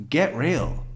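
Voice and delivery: normal talking voice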